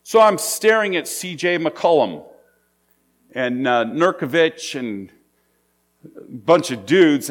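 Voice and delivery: ordinary talk, nothing marked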